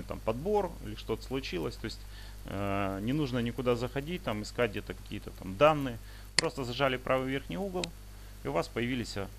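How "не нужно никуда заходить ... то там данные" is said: ordinary talk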